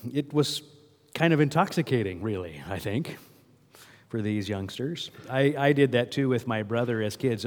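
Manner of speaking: ordinary talk, nothing marked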